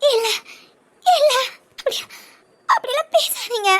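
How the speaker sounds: halting denial with long pauses; stunned pleading. Acted. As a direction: plead haltingly against the bad news. Refusing news that he is dying. (அதிர்ந்து தடுமாறிக் கெஞ்சல்)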